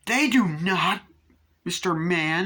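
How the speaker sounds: high, loud prim scolding; mock outrage. Imitating Annie who hates profanity, mock-outraged cry. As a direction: scold him shrilly in playful imitation. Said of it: "she hates profanity".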